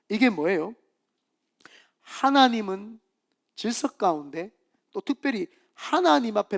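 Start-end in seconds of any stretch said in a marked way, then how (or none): none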